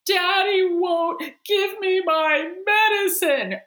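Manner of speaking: put-on high, loud, slow wailing small boy; whiny toddler voice. Mother imitating her crying son complaining about his dad, whiny. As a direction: whine it like a crying three-year-old. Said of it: "He goes".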